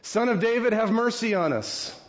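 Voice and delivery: high pleading call for mercy; blind men shouting. Acted. Blind men calling after Jesus, pitched high and pleading. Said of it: "the blind men shout out".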